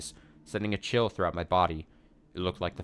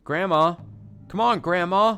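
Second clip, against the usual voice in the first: high, loud perky call; cheerful; 11 semitones higher, 7 dB louder, slower than usual. Acted. Girl trying to stop grandma's chanting, cheerful. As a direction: coax her brightly, much higher. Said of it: "speaking to her in a cheerful voice".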